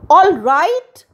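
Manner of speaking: high, loud, rising echo; incredulous high-pitched cry; indignant cry. A narrator's reading. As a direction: throw the question back in outrage. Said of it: "cried Cindy".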